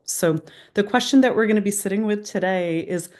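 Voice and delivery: ordinary delivery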